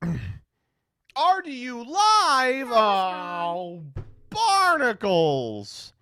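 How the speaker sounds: loud, high, very drawn-out wail; mock-anguished cry; hammed-up dismay. Real, not acted. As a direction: stretch each word into a cartoonish howl. Dismayed at another live rival.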